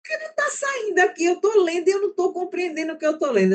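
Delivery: high-pitched, fast imitation of a struggling student; high, hurried mock student complaint; exasperated student. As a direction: mimic a student stuck on a text, pitched high. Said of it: "a menina começou a ler, e daqui a pouco ela fez".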